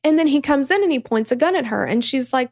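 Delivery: usual speaking voice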